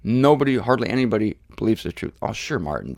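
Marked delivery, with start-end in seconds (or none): 2.2-3.0 mock dismissive. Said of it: "they all say".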